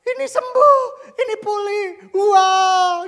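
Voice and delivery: high, loud, ecstatic cries; imitated hysterical outburst at a miracle; overjoyed caller. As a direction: shout the news of healing, overwhelmed. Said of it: "Dan orang itu".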